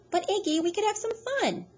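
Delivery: high wheedling woman. A narrator's reading. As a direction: coax him like a spoiled child. Loretta wheedling Iggy.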